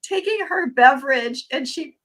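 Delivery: high, loud, breaking into laughter; laughing hard. Real, not acted. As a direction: tell it while cracking up. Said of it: "I'm laughing so hard".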